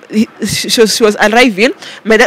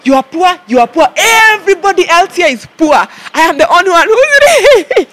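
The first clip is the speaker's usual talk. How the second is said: mock shout; 7 semitones higher than usual. Manic rant retold to father.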